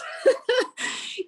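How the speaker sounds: airy high giggle, out of breath; giggling breathlessly after a workout; breathless high laugh. Real, not acted.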